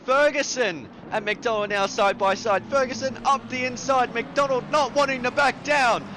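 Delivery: high, fast, excited race call; motorsport commentator. Real, not acted. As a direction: build the call as he heads for the wall. Live call of side-by-side fight.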